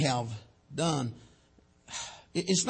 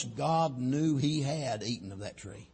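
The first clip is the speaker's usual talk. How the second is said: normal talking voice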